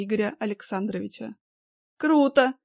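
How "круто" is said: кривая усмешка, громко; с кривой ухмылкой; криво усмехаясь. A narrator's reading. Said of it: "криво усмехнулся". (криво усмехаясь)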